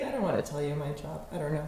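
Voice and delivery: low, soft, quick dodge; evasive mumble. Retold or imitated. Telling a stranger nothing about her job, evasive. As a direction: brush the question off under your breath. Acted dodging of job question.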